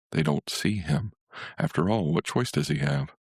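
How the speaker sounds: ordinary delivery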